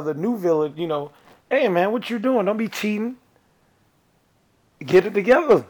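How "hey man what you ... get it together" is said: a hint of a tough hero voice, higher; Luke Cage voice. Friend lecturing a cheater in a tough-guy voice. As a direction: play the tough guy, lightly. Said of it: "Are you Luke Cage?".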